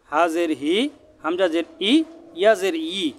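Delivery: each letter exact, ending in a held ee as in "বা জের বি"; chanted drill, lifting the final vowel as in "তা জের তি"; careful articulation drill. Shown as a demonstration. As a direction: articulate every Arabic letter from its proper place, "ই" drawn out. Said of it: "মাখরাজের দিকে খেয়াল করে হরফ উচ্চারণ".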